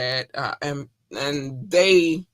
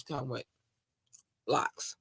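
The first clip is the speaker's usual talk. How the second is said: whisper; 4 semitones lower, 11 dB quieter than usual. Real, not acted. Whispered end of point.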